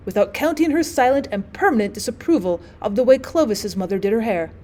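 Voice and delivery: usual speaking voice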